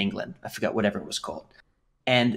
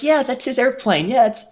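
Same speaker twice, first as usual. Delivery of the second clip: high, louder mock-mother voice; imitating mom; 6 dB louder than usual. Mom humouring her son, high mock-cheery voice. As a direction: humour the kid in a high singsong. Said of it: "And she'd be like".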